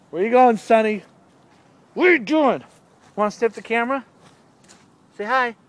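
high-pitched pet talk. Real, not acted. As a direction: coax the cat in a high voice. Coaxing the cat to the camera.

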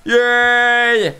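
high, long-held cheer; drawn-out cheer. Real, not acted. Player celebrating in the game, drawn-out cheer.

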